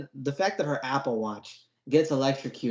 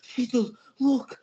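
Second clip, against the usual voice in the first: high, whiny mockery of a crying kid; mock the boy's overblown wailing; high sarcastic whine over a deleted playlist; whiny mock Crispin; 8 semitones higher than usual. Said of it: "He's like".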